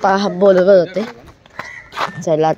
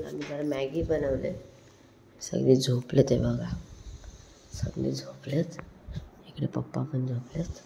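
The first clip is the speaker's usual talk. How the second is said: hushed so the sleepers stay asleep; soft low voice, keep it down; quiet at night; 6 semitones lower, 9 dB quieter than usual. Real, not acted. Everyone asleep, filming at night.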